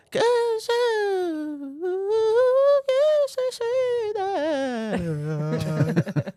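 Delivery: high, slow mocking voice; mock friend in high voice. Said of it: "They go".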